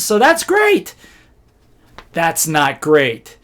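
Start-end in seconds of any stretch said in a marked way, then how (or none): 0.0-0.9 high-pitched upbeat mimicry; mock-cheerful conclusion; cheery self-satisfaction. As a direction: imitate someone naively pleased, voice lifted high. Retold clueless presenters' cheery answer.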